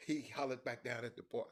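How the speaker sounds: usual speaking voice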